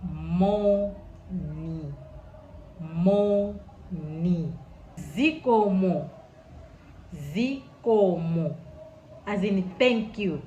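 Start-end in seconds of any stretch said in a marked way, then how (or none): 0.0-4.6 morning said slowly and clearly, lower; teacher modelling the greeting; Chichewa greeting. Shown as a demonstration. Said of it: "Say it like". 5.2-8.5 teacher modelling thank you; Chichewa word. Shown as a demonstration. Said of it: "Say it like".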